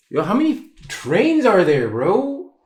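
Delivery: suddenly notice the trains and exclaim; real startled exclamation to a friend; surprised aside. Real, not acted. Distracted aside at passing trains.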